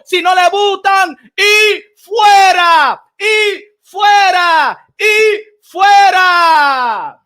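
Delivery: loud, high rally shout, slowing at the end; furious shouted call to get out; shouting rant. Acted. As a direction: yell it to a crowd, fist in the air. Rally rant against haters.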